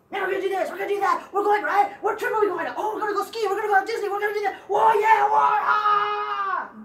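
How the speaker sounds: mock family excitement building to a whoop; excited family chatter. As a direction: act out an excited family trip plan. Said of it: "You should talk like that".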